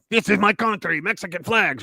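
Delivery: hammed-up mock Mexican accent; terrible Mexican accent. Host imitating flag-waving protesters, bad Mexican accent. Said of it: "That's a terrible Mexican accent".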